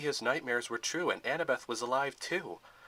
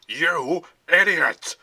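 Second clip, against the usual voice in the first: very loud, slow, higher roar; 5 semitones higher, 13 dB louder, much slower than usual. A narrator's reading. General to the guard, a huge slow roar. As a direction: explode at him. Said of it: "roared the general".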